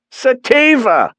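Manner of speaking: high, slow correcting voice; Dad voice, pitched high. A narrator's reading. Father correcting mother on the call, high voice.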